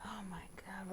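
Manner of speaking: breathless shocked whisper. Real, not acted. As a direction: whisper it in disbelief. Realizes ship is too far.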